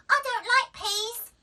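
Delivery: put-on high, loud little-girl whine; child's refusal. A narrator's reading. Daisy refusing her peas, high and whiny. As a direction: squeal it like a fussy child. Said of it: "said Daisy".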